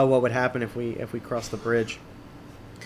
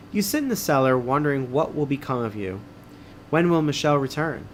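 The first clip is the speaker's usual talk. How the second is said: ordinary talk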